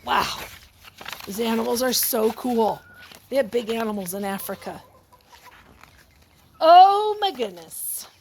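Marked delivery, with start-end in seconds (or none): none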